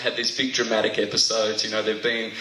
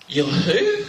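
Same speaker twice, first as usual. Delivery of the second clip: high, louder startled mum; mum's shock; 6 semitones higher, 4 dB louder than usual. Retold or imitated. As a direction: mum can't believe her ears. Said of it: "my mum goes".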